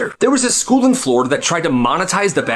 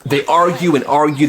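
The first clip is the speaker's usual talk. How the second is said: plain reading voice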